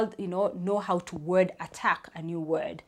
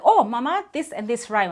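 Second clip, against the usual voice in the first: put-on child's excited alert; 5 semitones higher, 7 dB louder than usual. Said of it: "alert me, like".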